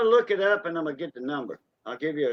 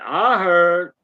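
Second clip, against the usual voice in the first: imitated call-back; 2 semitones higher, 7 dB louder than usual. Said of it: "She said".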